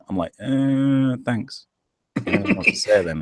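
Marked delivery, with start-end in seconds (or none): 0.4-1.7 awkward embarrassment. Retold or imitated. Recalling a compliment on his accent, embarrassed. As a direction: play bashful, stalling on the uh. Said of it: "it always makes me kinda blush".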